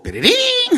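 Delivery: high, loud vocal squeak; imitated clarinet squeak. Student cracks the hard clarinet passage, imitated squeak. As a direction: squeal it like a botched note. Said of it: "이렇게 삑사리가 나는 데였는데".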